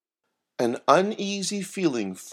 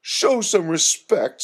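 slow, higher-pitched reprimand; scolding; 6 semitones higher, 4 dB louder, slower than usual. A narrator's reading. Myrtle telling off the joking Herbie, scolding. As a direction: scold him slowly and high. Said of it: "scolded Myrtle".

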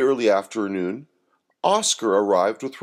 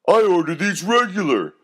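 high, loud thug yelling at the barista; hammed-up yelling thug; yelled; 9 semitones higher, 3 dB louder than usual. A narrator's reading. First thug yelling at Rembrandt over coffee, loud and high. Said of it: "yelled the first thug".

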